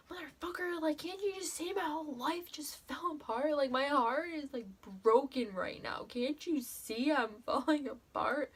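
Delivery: high-pitched pleading rant, voice climbing; cursing him out in her head, desperate and upset; inner rant at the coach. Retold or imitated. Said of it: "cussing him out in my head".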